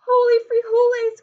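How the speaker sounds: high, loud exclamation; high loud battle cry; shout. Acted. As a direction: cry out as you strike. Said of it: "cried Scipito".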